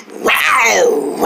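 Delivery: long, drawn-out rawrs; angry tiger roar. Acted. Angry over pizza, tiger roaring. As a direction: roar out the frustration. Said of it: "I can just growl".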